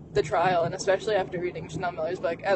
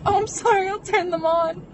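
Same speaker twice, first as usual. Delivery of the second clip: retold hysterical reply; 12 semitones higher, 7 dB louder than usual.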